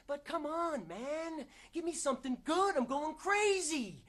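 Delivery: high-pitched, exasperated begging; desperate pleading. Acted. Begging for something good, high frantic plea. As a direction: plead up high, stretching the last words. Character begs for a good role.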